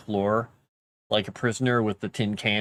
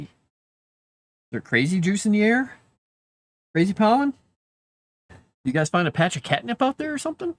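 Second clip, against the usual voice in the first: teasing pet voice; 8 semitones higher, 3 dB louder than usual. Real, not acted. Teasing the cats about catnip, in high pet talk. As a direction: tease the kitties in a sing-song coo.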